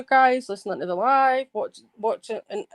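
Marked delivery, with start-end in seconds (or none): none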